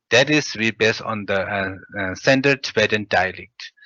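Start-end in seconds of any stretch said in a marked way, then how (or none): none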